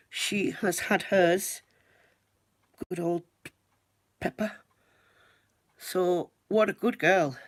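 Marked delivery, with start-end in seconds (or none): 2.9-7.4 sweet talking to a pet; praising pet voice. Real, not acted.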